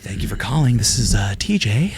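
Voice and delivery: soft, breathy call voice. Shown as a demonstration. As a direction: breathe it out gently on the phone. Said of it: "that soft, breathy voice that he does".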